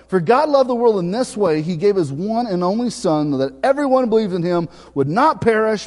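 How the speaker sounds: loud, high preaching voice, driving; full-force preaching. Acted. Pastor preaching to the congregation on God's love, full force. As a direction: preach it out to the back pew.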